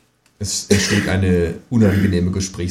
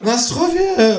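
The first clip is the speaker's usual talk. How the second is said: toasting call. A narrator's reading. Kangaroo calls out a vodka toast. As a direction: shout the toast merrily. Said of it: "ruft das Känguru".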